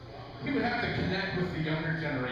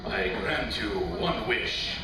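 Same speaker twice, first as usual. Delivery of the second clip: low, slow genie voice; booming genie; 7 semitones lower, much slower than usual. Retold or imitated. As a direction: grant the wish like a grand genie. Genie speaks from bottle.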